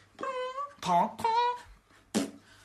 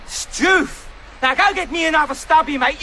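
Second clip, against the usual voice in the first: high, exasperated mock-Aussie; irate Aussie slang in a put-on accent; Australian accent; 5 semitones higher, 3 dB louder than usual. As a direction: slip into a hammed-up Australian accent. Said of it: "You've got me doing it now".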